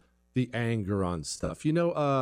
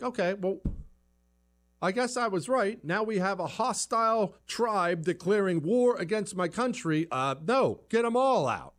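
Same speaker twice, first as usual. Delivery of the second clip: high-pitched imitation of an alarmed citizen; mock indignant onlooker; 8 semitones higher than usual. Onlooker reacting to a hostile tribe, mock indignation. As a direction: act out an onlooker deciding against them.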